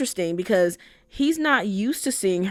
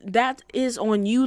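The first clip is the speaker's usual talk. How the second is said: ordinary delivery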